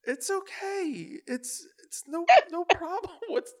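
calm a crying new hire, gently; reassuring. Retold or imitated. Manager comforting the sobbing new girl, slow and soothing. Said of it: "And I was like".

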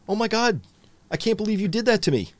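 high-pitched, hammed-up wounded disbelief; mock narcissistic outrage. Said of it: "interpret your boundaries as a narcissistic injury".

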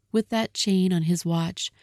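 ordinary delivery

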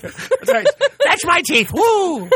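high giggly granny voice; old lady voice. Pretend. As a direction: cackle like a tipsy grandma. Mocking old Woo Grandma.

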